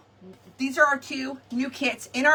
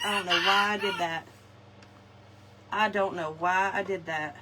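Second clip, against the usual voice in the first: ordinary delivery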